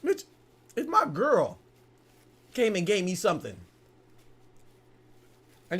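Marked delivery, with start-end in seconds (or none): none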